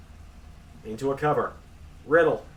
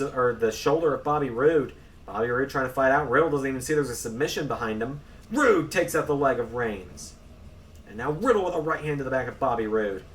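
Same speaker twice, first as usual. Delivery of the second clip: ordinary talk, nothing marked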